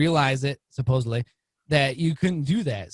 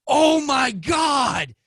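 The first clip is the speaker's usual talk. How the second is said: loud, high, drawn-out gasp; mock gasp; 8 semitones higher, 4 dB louder, much slower than usual. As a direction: stretch it out in shock. Woman realises he's the weatherman.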